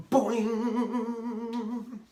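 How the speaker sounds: long ringing, wavering gong imitation; resounding gong. A narrator's reading. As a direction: let the boing vibrate on and on. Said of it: "whacked the large gong".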